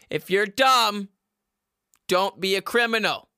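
normal talking voice

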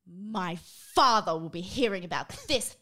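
sneering schoolboy villain impression, spitting out the last word; Malfoy. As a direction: put on a haughty villain's voice. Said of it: "can you do a Malfoy impression?".

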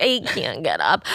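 low, soft, slow imitation of exhaustion; mock feeble groan. Imitating hungover friend refusing to leave bed, slow groan.

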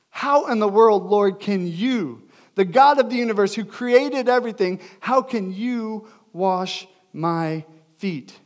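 loud, high protest slowing to word by word; indignant. Acted. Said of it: "almost like he's indignant".

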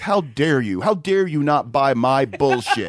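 low, drawn-out indignation breaking into laughter; hammed-up affronted scammer; mock outrage. Caught scammer berates the worker, mock affronted. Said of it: "come around and act".

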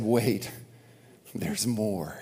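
soft, low, reverent hush; quiet. Retold or imitated. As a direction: say it softly, in awe. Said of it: "and who said".

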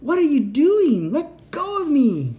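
very high, loud, slow panicked cries; desperate screaming. Acted. Cat screams at the robot to let her go, high and loud. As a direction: scream for release, terrified.

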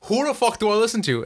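retold livid outburst; overwhelmed by two people giving orders. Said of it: "I'm so livid 'cause I was being yelled at".

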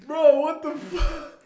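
high, loud, drawn-out disbelief; mock outraged shock. Friend finding pizza on the bare oven rack, mock outraged. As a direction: imitate a friend's horrified outburst.

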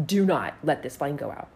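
low, grave retold warning; solemn imitation of the neighbor's order; dead serious. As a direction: say it low and evenly, no joking. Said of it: "Dead serious, just like".

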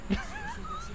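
high, light burst of laughter; real laughter. Real, not acted. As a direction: laugh at your own story. Laughs at own retold quote.

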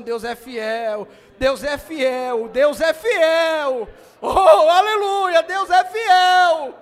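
slow, rising to a full preaching shout; building proclamation. Real, not acted. Preacher to congregation, slow building shout into roaring proclamation. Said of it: "vou continuar falando pra você cravar".

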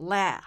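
ラ as a loud, drawn-out English 'læ'; long, wide vowel. Shown as a demonstration. Teacher isolates the key syllable, long wide American A. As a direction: open ラ wide and hold it insistently. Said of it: "しつこく長く口横にも縦にも広げて".

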